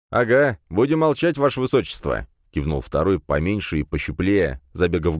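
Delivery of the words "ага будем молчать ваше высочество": higher, shifty small guard; eager, eyes darting; nervous little mage. A narrator's reading. (второй маг, суетливо)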